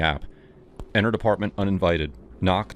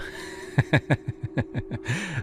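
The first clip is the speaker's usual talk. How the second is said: creepy giggle; 8 semitones higher, 4 dB quieter than usual. Acted. Creepy guy laughs.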